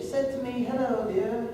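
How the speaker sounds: sweet lady greeting. Shown as a demonstration. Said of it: "Or like an older lady".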